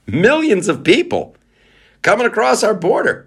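high, hammed-up alarmist voice; mock alarmist. Podcaster mocking alarmists, hammed-up fearful voice. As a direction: ham up the border panic.

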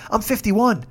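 ordinary talk

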